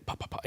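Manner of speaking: a few soft, quick pops; imitated wing beats. Shown as a demonstration. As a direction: lightly mimic a bird's wings beating. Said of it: "So I do the same thing".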